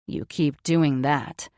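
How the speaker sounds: low, slow, lightly groaned man's voice; groaning. A narrator's reading. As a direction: hold back a groan as a lover. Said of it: "he groaned".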